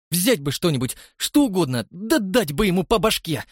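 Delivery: high, loud furious outburst; angry outburst. A narrator's reading. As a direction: lash out in rage. Гневный порыв Джоан. (яростная вспышка)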